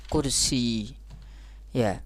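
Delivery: normal talking voice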